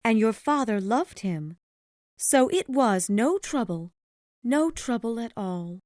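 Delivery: high-pitched mother's voice, remembering fondly; high character voice of a grieving mother; tender mother. A narrator's reading.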